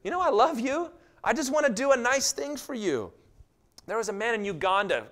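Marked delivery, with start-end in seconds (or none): 0.0-3.1 God tender. Acted. God to a believer asking for healing, gentle and fond. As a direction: speak like a friend who just likes them. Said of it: "And God goes".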